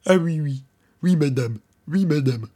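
mocking a servile underling; meek yes-man. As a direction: grovel to the boss, eager to please. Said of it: "Il dit".

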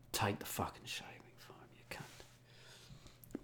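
whispered insult under the breath; resigned, hushed cursing; muttered curse. Retold or imitated. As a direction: swear barely audibly, so they can't hear. What he really muttered at security.

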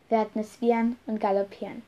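ordinary delivery, nothing marked

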